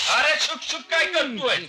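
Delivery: high-pitched exasperated scolding; indignant retort. Acted. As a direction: scold him for shushing you. Uncle rejects being hushed. (वैतागून उंच आवाजात)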